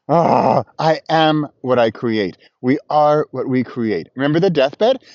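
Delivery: usual speaking voice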